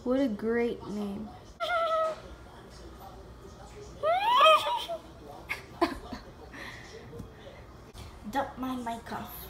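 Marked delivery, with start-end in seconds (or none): 1.3-8.3 long high burst of real laughter. Real, not acted. Laughing at a funny player name.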